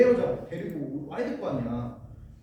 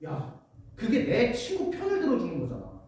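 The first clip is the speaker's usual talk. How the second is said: ordinary delivery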